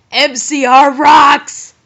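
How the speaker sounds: loud, drawn-out fannish cheer; excited cheer. Acted. Fan signing off an author's note, excited cheer. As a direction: belt it out like a proud fan.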